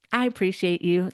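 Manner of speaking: normal talking voice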